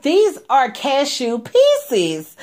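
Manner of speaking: slow, pointed, unimpressed; pointed disapproval. Retold or imitated. Mentor slowly pointing out the cashew pieces. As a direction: spell out the flaw slowly.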